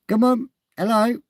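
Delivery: high loud call to get attention; loud high-pitched call; calling out loudly. Real, not acted. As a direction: shout across to a stranger. Calling out to man outside.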